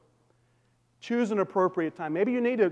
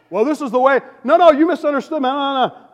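higher, louder mock protest; defensive interrupting; 9 semitones higher, 11 dB louder than usual. Husband butting in on his wife, mock defensive protest. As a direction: cut in, hammed-up defensive.

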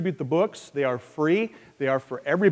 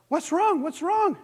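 much higher, anxious questioning; worried fathers asking; 14 semitones higher, slower than usual. Acted. Fathers in the waiting room ask the fainted man, high and anxious. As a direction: ask twice in a high voice, fretting.

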